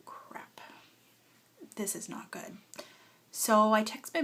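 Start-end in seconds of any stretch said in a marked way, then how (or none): 0.1-2.6 softer, breathy whispered dread; dismayed whisper. Retold or imitated. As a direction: hush it like bad news sinking in. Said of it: "I was just like".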